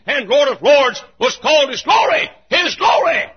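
high, loud, ringing pulpit proclamation; preaching at full force. Acted. Preacher to his congregation, proclaiming at full force. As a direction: build each line to a shouted peak.

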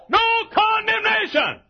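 high, loud preacher's proclamation; full-voiced pulpit cry; shout. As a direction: shout it like a triumphant verdict. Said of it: "as I analyze this shout".

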